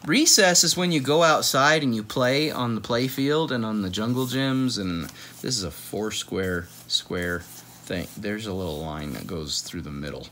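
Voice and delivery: ordinary delivery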